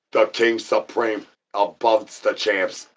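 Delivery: slow, grand ring announcer intro. Acted. Presenting the king to viewers in a slow announcer voice. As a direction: crown the champion slowly and grandly.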